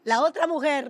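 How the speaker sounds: mock-dramatic Spanish aside; joking mock jealousy. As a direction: play up the wronged woman, kidding. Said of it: "No, I'm just kidding".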